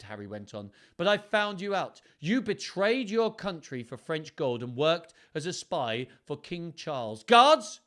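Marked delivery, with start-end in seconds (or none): 1.0-7.2 commanding king. Acted. King arrests traitor. 7.2-7.8 loud, high call to the guards; king summons the guards with a loud call. Acted.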